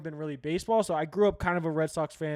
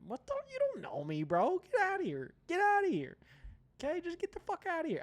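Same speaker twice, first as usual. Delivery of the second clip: much higher, incredulous brush-off; high-pitched dismissal; 13 semitones higher than usual. Real, not acted. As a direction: wave the critics away in a high voice. Mock dismissal of online critics.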